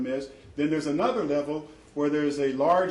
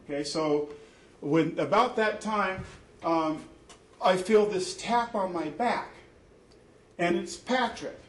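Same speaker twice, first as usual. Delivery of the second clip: ordinary talk, nothing marked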